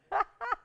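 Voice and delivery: a short high laugh bursting out; burst of high laughter. Real, not acted. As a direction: laugh it off, caught by surprise. Laughs at per carat price.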